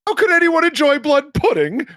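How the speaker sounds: high, loud incredulous repeat; shrieked consternation, badly faked British; outraged British questioning. Shown as a demonstration. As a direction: echo it back as an appalled question. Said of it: "repeating of what somebody said in the form of a question".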